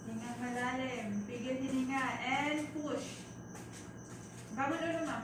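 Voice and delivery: firm, loud push commands; loud coaching. Real, not acted. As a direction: drive her to push hard. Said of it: "coaching a mother to push". (malakas na pag-uutos sa panganganak)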